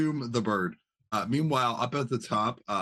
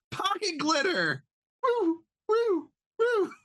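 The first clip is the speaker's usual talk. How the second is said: squeaky high gnome voice, shrieking with glee; manic gnome; slower than usual. Acted. Gnome showing off to the space pirate, squeaky and manic. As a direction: pitch way up like a giddy little gnome.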